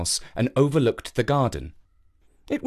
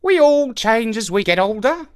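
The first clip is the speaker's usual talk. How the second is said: high wise crow voice; 9 semitones higher, 4 dB louder than usual. Acted. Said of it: "said the crow".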